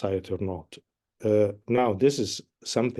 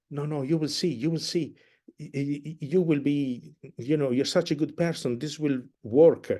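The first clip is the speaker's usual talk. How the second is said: gentle reassurance; 5 semitones higher than usual. Retold or imitated. Conductor voicing Germont gently reassuring Violetta. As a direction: gently shake her into hope. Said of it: "gently, gently shakes her".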